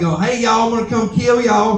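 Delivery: high hillbilly twang; hillbilly robot. Acted. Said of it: "sound like the robot was a hillbilly".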